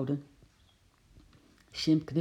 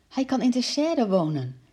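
put-on higher-pitched eager child; quick, eager suggestion; 7 semitones higher, 4 dB louder than usual. A narrator's reading. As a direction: jump in with a lifted voice. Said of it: "zei Lotje snel". (vlug en gretig voorgesteld)

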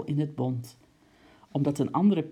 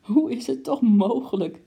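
much higher, louder, bursting with laughter; spluttering laugh; 8 semitones higher, 7 dB louder than usual. Acted. Lady cries out laughing at her ruined coat, high and loud. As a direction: cry it out while snorting with laughter. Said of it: "riep ze steeds weer proestend uit".

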